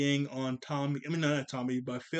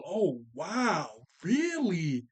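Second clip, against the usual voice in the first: phony fake enthusiasm; 3 semitones higher, 3 dB louder, much slower than usual. Brother faking excitement to humour Dill, phony amazed voice. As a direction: ham up fake wonder, like you don't mean it. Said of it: "that's how he's talking".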